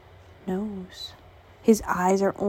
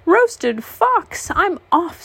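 fox voice; 10 semitones higher, 4 dB louder, much slower than usual. Acted. Fox character voice in story.

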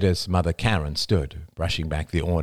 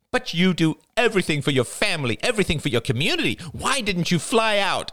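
put-on accusing young woman; 12 semitones higher than usual. A narrator's reading. Jada accuses Marie.

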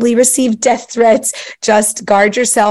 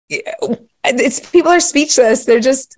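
ordinary talk, nothing marked

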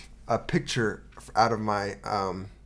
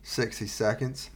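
ordinary talk

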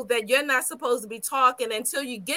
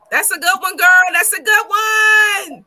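excited cheer; 7 semitones higher, 9 dB louder than usual. Real, not acted. Cheering a friend's parenting boundary, loud and excited. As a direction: hype her up, louder each time.

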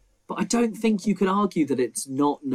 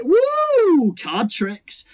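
hammed-up much higher whoop, then a showy announcement; showy magician; 6 dB louder, much slower than usual. Magician showing off card tricks, hammed-up whoop. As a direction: whoop it up like a cheesy stage magician. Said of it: "and they go".

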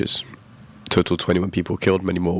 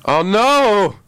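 high, loud panicked squeal; 12 semitones higher, 3 dB louder, slower than usual. Real, not acted. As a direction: panic at being quizzed. Panicked at quiz.

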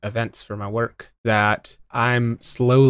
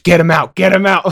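higher, louder egging-on; mock urgent cheering; 5 semitones higher, 6 dB louder than usual. Salon ladies crowding round an ingrown hair, mock urgency. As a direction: urge them on as an excited onlooker.

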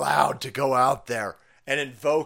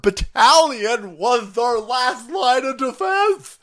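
higher, louder, slow hammed-up panic; panicked Pentagon official; 8 semitones higher, 7 dB louder, slower than usual. Pentagon official mocked by streamer, high panicked wail. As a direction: wail it like the sky is falling. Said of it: "Some cross-dresser at the Pentagon is like".

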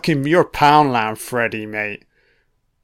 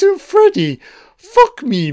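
much higher, louder outburst at Freddy; high-pitched exasperation; 5 dB louder than usual. Real, not acted. Commentator telling off Freddy for copying Halloween, squawked exasperation. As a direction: let the voice leap up in disbelief. Mock-scolding Freddy on screen.

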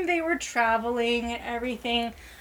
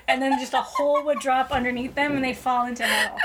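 ordinary talk

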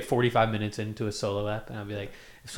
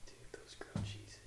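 whispering; 20 dB quieter than usual. Real, not acted. Unvoiced aside about food.

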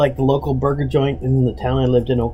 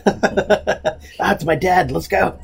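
imagined voice; 7 semitones higher, much slower than usual. Retold or imitated. Imagined recruiter fleeing dad.

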